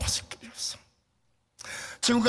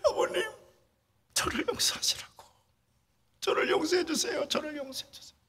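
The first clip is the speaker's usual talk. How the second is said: tearful plea; 11 semitones higher than usual. Retold or imitated. Kneeling at father's grave.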